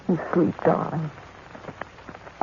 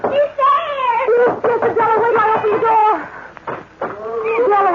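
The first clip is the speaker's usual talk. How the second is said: rising hysteria; 14 semitones higher, 3 dB louder than usual. Acted. Jane alone at night hears the creature, hysterical panic. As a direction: panic climbing much higher and louder into a shout. Terror builds into hysterical shouting.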